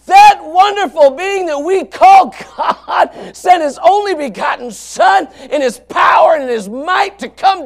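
loud, high fervent preacher voice; preaching at full force. Acted. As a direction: preach it out, fired up about heaven.

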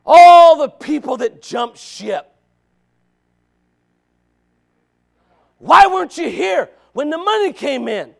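loud, high pulpit reproach; booming challenge, high and loud; full-force preaching. Real, not acted. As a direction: call out the deserters from the pulpit.

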